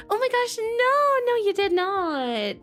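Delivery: high, drawn-out fond "no"; emphatic reassurance. Real, not acted. Streamer reassuring a worried late viewer, emphatic drawn-out reassurance.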